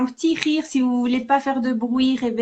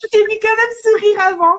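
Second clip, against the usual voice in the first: laughing tease; 7 semitones higher, 6 dB louder than usual. Real, not acted. Teasing partner mid-exercise.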